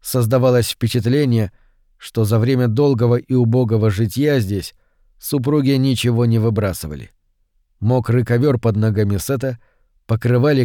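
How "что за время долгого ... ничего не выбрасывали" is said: ordinary delivery, nothing marked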